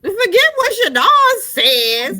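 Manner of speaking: high, loud, slow sneer at the advice; loud mock of a stubborn mom; mocking defiance. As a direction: belt it out mocking the mom's defiance. Mom defies daughter's advice.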